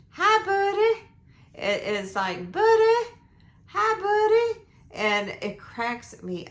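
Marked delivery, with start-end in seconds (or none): 0.1-1.1 high, loud imitation of a little girl; toddler Southern belle. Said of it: "She sounds like a Southern belle". 2.5-4.7 high, loud, drawn-out toddler imitation; toddler Southern belle. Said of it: "She sounds like a Southern belle".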